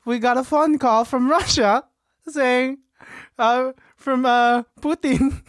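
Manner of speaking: high secretary voice, halting; secretary Sasha. Acted. Secretary recounts Putin's phone call, high halting voice.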